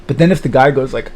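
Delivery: ordinary talk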